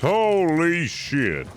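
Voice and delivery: high, loud and very slow; stunned awe. Acted. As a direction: drag it out, still reeling. After bear attack, stunned.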